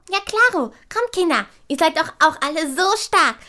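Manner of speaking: ordinary talk, nothing marked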